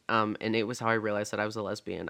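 normal talking voice

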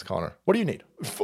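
usual speaking voice

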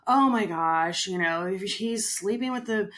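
loud, high, dramatic gossip; mock a scandalized agent spilling secrets; gossipy blabbing. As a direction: dish it out loudly, stretching the "oh my gosh".

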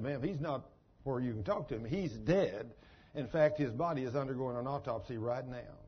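low, softly spoken retelling; hushed hospital reply. Retold or imitated. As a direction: break it to a mother quietly, low. Hospital worker answers grieving mother.

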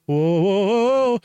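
loud whoas climbing in pitch; mock alarm. Imitates friend calling out in alarm.

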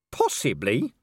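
questioning, with a swooping rise; incredulous echo. Acted. Vimes echoes Death's answer, incredulous rising question. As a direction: throw the word back as a disbelieving question.

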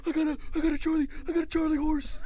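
high, loud, fast panicked stammer; pained yelling. Retold or imitated. Friend explaining his cramp, frantic pained yell. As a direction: blurt it out through mock agony.

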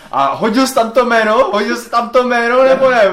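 high, loud mock interrogation; agitated Dumbledore. Headmaster grabs Harry by the neck, demanding answers, agitated shouting. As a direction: shout it urgently, imitating an enraged headmaster. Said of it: "přiběhne s Harrym, vole, chytne ho pod krk".

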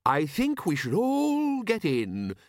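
high, slow, lilting suggestion; mild eccentric inventor. A narrator's reading. Inventor suggests everyone board, high and mild. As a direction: urge them gently in a high voice. Narrator voices the inventor Leonard.

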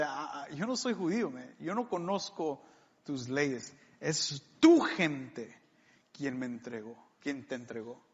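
quiet, dismissive sarcasm as Pilate; sarcastic reply. Retold or imitated. Preacher voicing Pilate's dismissive reply to Jesus, quietly sarcastic. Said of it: "sarcásticamente".

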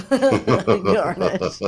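laughing. Real, not acted.

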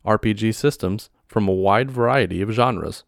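upbeat host intro over music; podcast intro. Acted. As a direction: announce the show brightly.

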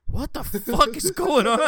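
high, loud, slow disbelief; mock bewildered. People hearing the story react, slow loud disbelief. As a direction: drag out the shock.

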